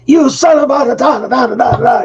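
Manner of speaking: high-pitched mock tirade, words replaced by da da; mock cussing. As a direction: imitate an angry man swearing at the door. Angry father-in-law cussing him out.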